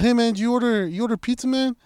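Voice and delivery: high-pitched retold question; hailing a stranger. Driver asking a guard about the pizza order, hailing him. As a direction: call it over, pitched well up.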